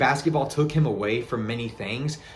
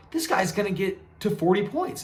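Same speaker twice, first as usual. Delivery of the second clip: higher-pitched retold amazement; amazed fan; 8 semitones higher than usual. Fan watching Kobe keep scoring, high-pitched amazement. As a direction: relive mounting disbelief in a high voice.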